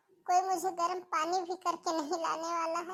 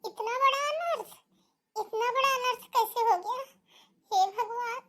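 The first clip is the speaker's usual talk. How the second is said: higher-pitched dismayed outcry; acted shock; 6 semitones higher, 3 dB louder than usual. Mother reacting to her daughter's terrible news, shocked. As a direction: voice rising in alarmed disbelief.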